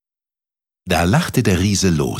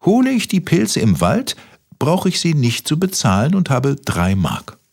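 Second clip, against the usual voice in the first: lightly voiced boy, higher pitched; reckoning to himself; 9 semitones higher than usual. A narrator's reading. Kaspar adds up his money in his head, higher reckoning voice. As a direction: work it out in a raised, lilting voice. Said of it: "und rechnete im Kopf".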